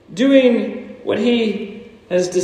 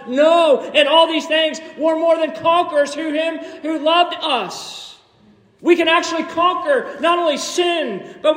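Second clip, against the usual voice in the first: verse read out high and ringing, near a shout; preaching shout; 7 semitones higher than usual. Acted. Preacher to congregation, reading Romans, rising to a ringing shout. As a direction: lift the verse to a triumphant call.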